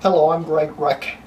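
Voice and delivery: usual speaking voice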